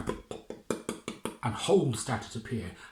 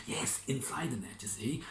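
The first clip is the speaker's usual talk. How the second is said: conspiratorial whisper; 5 dB quieter than usual. Acted. Fisherman whispering the secret part of the plan. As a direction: lean in and whisper it. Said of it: "whispered aside".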